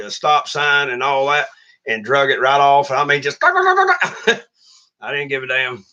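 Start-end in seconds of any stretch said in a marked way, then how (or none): 3.4-4.4 short laugh. Real, not acted. Laughs at his own story.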